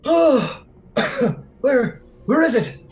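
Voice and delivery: high, loud, gasping panic; panicked awakening. Acted. Freed man waking in a high loud panic. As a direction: wake up coughing and frantic. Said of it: "He goes".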